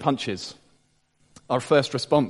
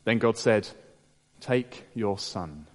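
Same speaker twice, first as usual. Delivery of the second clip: scripture reading; 2 semitones lower than usual. Acted. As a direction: read the passage gravely, letting each phrase land. Preacher reads the Genesis passage.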